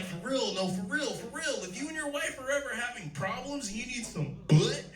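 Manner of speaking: hammed-up high voice of the homeboy insisting; earnest homeboy. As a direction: swear it over and over like he means it. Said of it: "He's like".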